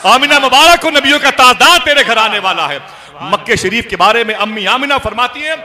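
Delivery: very high, very loud proclamation; full-force sermon voice; impassioned preaching. Real, not acted. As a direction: build to a shouted glad tiding.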